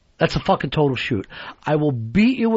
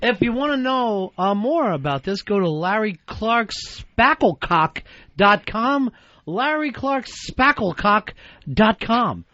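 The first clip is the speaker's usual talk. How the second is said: drawn-out, higher-pitched mock website plug; Fez; 4 semitones higher, slower than usual. Mimicking a co-host plugging a website in an interview, drawn-out mock plug. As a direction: stretch out the web address, pitched up. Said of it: "Fez is like this".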